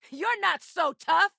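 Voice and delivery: high, drawn-out battle taunt; shouted taunt. Acted. Taunting an enemy in a fight, shouted. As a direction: yell it defiantly at the enemy.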